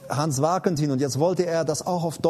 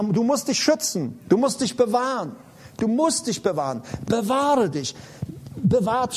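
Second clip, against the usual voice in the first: higher and louder, urgent sermon voice; preaching at full force; 6 semitones higher, 3 dB louder than usual. Acted. Preacher urging listeners to leave harmful people, full-force preaching. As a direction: press the call to guard yourself.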